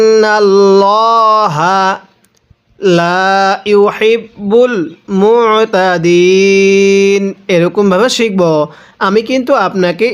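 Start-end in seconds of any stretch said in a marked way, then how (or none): 0.1-7.2 slow, drawn-out Quranic chant; measured tajweed recitation. Shown as a demonstration. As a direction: recite each word slowly and melodically.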